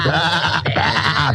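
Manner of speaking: high bleats from rescued sheep; cold sheep call out after being dug up; sheep bleating. A narrator's reading. Bleating like sheep. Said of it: "they shouted".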